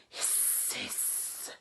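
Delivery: soft airy hiss of fire; hissing flames. Acted. Dragon's nostrils flaring, soft hissing flames. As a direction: let the flames hiss quietly.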